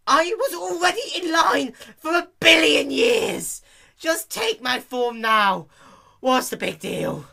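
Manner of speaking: high, loud, slow outraged shout; furious yell. A narrator's reading. Dash losing it at the slow clerk, loud outraged shout. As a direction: blow up at the clerk.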